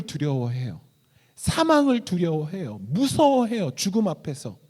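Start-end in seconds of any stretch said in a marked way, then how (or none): none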